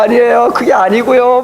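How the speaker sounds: put-on a boy protesting his innocence; boy's excuse. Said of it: "막 변명을 하는데".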